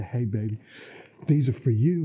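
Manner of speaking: mock romantic husband at the door; light playful offer of flowers; lightly voiced husband handing over flowers, low. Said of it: "I say".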